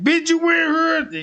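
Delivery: high, loud yelling call; shouting boyfriend breaking in; angry shout. Retold or imitated. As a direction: bellow it through the house.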